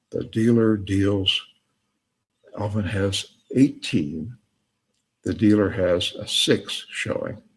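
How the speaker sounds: ordinary talk, nothing marked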